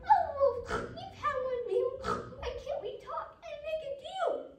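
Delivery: very high, loud pleading squeal; high piggy plea. Acted. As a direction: squeal it out as a desperate little pig. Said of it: "began to squeal. He cried".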